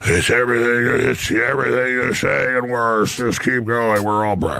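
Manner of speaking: slow, strained deathbed voice; a hint of a dying old relative; dying words. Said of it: "[name] doing an impression of a dying relative".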